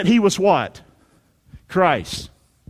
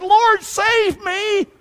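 high, long-drawn desperate cry; frightened cry; 14 semitones higher, 3 dB louder, much slower than usual. Acted. Sinking Peter cries to Jesus, high frightened plea. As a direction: cry out for rescue, stretching each word. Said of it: "Peter was afraid and beginning to sink".

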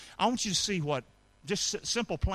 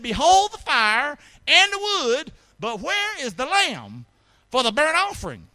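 Isaac's question; 8 semitones higher, 7 dB louder than usual. Acted. Isaac questioning his father, read aloud.

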